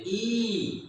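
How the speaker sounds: ई said with force, pushed out; emphatic ई. Shown as a demonstration. Teacher to children, showing the vowel ई with force. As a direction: press on the vowel ई firmly. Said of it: "कुछ जोर दे के बोलते हैं".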